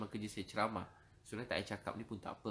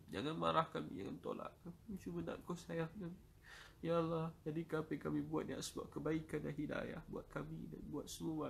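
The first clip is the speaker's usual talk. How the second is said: higher, straining plea on the edge of tears; impassioned pleading prayer; 5 semitones higher than usual. Real, not acted. Preacher begging God for mercy, higher straining plea. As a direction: beg God not to reject them.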